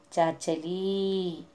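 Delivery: चाचली said very slowly, the long vowel drawn out; long vowel shown. Shown as a demonstration. Teacher shows pupils the long vowel, slow चाचली. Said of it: "चाचली वो दीर्घ बोलना पड़ता".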